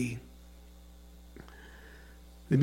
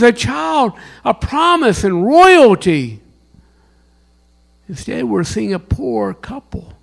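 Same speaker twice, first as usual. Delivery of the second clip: raised, pleading preaching; preaching the wise men's view; 9 semitones higher, 6 dB louder than usual. Acted. Pastor voicing the wise men to congregation, fervent preaching. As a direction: plead their disappointment.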